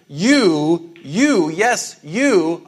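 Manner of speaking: single out the listener with every "you"; emphatic you. Acted. Jesus singling out the disciples, pressing "you". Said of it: "there's ways you can kind of boldface a word".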